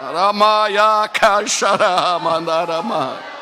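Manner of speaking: slow, stretched ecstatic syllables; speaking in tongues. Real, not acted. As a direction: pray in tongues, drawn out.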